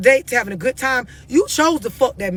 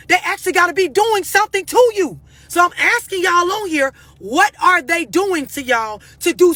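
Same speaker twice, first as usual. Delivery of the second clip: raised ranting voice; 6 semitones higher than usual. Real, not acted. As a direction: demand an answer, incredulous. Ranting at the audience.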